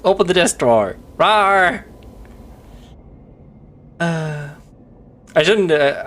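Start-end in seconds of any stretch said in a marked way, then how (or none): 1.2-1.9 playful higher, louder roar; mock dinosaur roar. Streamer roaring as the pet dinosaur, hammed-up.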